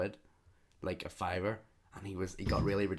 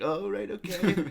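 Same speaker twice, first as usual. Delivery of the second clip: awkward reply; 10 semitones higher, 3 dB louder, slower than usual. Retold or imitated.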